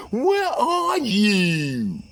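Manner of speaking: high, slow, drawn-out whine; mistress voice. Retold or imitated. Mistress calling back to demand where he is, high slow whine. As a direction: sing it out high and slow like she's fed up. Mistress repeats her question.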